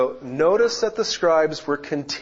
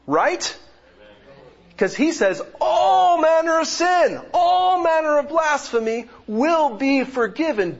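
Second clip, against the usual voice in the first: loud, high, building preacher's cry; preaching at full force; 9 semitones higher, 5 dB louder than usual. Acted. Pastor urging the congregation that all sin is forgiven, loud preaching. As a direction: proclaim the forgiveness, rising to a shout.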